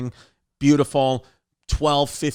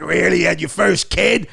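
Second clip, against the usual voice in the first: Steve Sciarippa; 2 semitones higher, 3 dB louder than usual. Retold or imitated. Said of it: "Steve was like".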